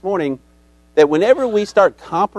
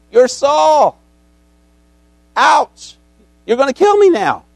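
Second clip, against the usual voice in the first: high, loud frightened realization; panicked medium; 10 semitones higher, 4 dB louder than usual. Retold or imitated. Medium realizes she faces King Saul, high frightened panic. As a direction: act the medium's dawning terror. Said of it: "The only one that could call up Samuel".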